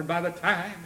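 normal talking voice

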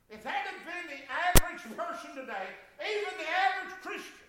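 ordinary talk

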